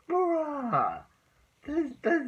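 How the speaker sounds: stunned high-pitched bruh; incredulous bruh. Real, not acted. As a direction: squeal it like you can't believe it. Incredulous outburst about the principal.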